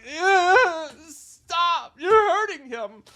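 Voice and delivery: high, loud, strained pleading with a laugh; high retold panic; pleading at screen. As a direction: beg the killer to stop.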